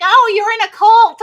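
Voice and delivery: high, loud sneering mimic of critics; mock the people calling him a cult member; mocking accuser. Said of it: "everyone's like".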